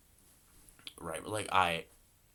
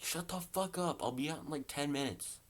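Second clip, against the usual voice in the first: hollering back; 7 semitones higher, 4 dB quieter than usual. Retold or imitated. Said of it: "he hollers out of his window".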